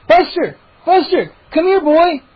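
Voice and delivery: loud high call to the dog. A narrator's reading. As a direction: shout up to the window. Calling the dog.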